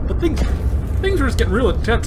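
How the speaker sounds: talking while choking on spit. Real, not acted. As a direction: fight a bit of choking on spit. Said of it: "I'm choking on my own spit".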